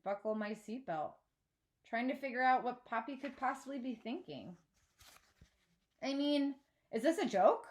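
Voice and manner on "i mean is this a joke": rising, stunned and hurt; upset outburst. Acted. Girl bursting out at her father in the car, upset. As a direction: blurt it out in disbelief. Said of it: "I burst out".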